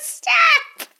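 high, loud playful shout. Real, not acted. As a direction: yell it to cut the joke off. Friend echoes stop, laughing.

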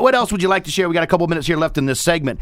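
usual speaking voice

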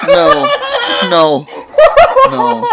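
high, loud hammed-up wails; mock anguished no. Friend mourning Gary hit by a car, hammed-up wails. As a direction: wail it like fake tragedy.

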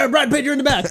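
high-pitched joke character voice; hammed-up high order; mock calling out. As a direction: call back to your passenger in a silly high voice. Joking acted order on imagined motorcycle.

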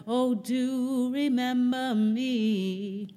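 ordinary delivery

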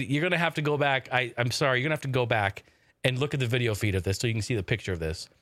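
ordinary talk, nothing marked

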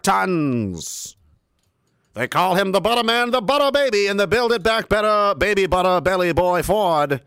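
put-on booming old-timey ring announcer; boxing ring announcer. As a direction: announce the fighter like a fifties radio host. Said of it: "old-timey radio voice".

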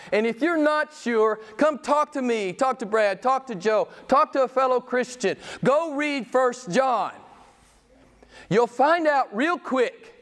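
high, slow pastoral appeal; earnest exhortation. Acted. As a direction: plead gently but urgently.

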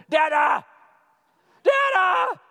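loud, high shout across the house; mock little girl yelling for her dad; imitated calling child. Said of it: "then I'll just hear".

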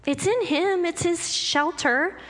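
ordinary talk

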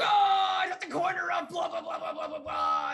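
loud, high shouted directions, blah blah; hammed-up shout. Psycho Gorman yells directions at the man on the toilet. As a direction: yell vague directions like a monster. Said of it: "He's like".

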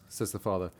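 plain reading voice

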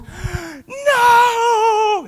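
long, high, loud mock funeral wail; hammed-up cry of grief, voice soaring; anguished wail. Mourner crying out at the house, acted anguished wail. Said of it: "filled with anguish, crying out".

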